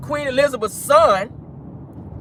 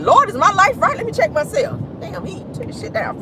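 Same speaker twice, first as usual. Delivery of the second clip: mock alarm; 10 semitones higher than usual. Retelling own panic about heat.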